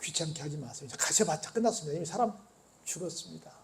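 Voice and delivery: 낮고 작게 가라앉은 전언; 나직하고 조심스럽게 체념시키듯; 비보를 전하는 사람. Retold or imitated. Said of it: "죽었다는 비보를 전달을 합니다". (조용히 가라앉은 목소리로 전하는 비보)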